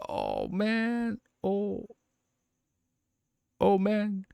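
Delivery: high, soft, drawn-out lament; real, choked-up reaction; moved dismay. Real, not acted. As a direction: let the story hit you, softly. Moved by the story.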